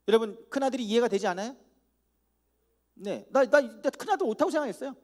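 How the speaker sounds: ordinary talk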